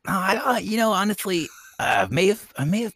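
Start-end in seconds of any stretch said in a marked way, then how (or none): none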